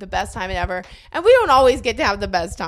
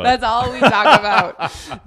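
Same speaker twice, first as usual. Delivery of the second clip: slow mock echo; 5 dB louder, much slower than usual.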